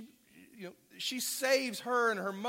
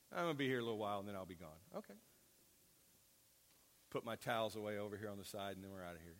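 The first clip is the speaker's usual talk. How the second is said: mock casual renter; 8 semitones lower, 7 dB quieter, much faster than usual. Mock tomb renter's casual chatter.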